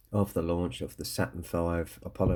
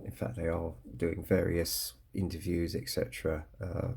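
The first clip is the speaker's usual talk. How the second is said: ordinary talk, nothing marked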